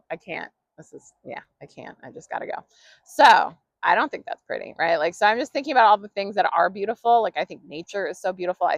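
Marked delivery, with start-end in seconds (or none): 0.1-2.6 softer and softer until whispered; fading mock retreat. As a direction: trail off into a whisper as if backing away. Friend fleeing an angry ranter.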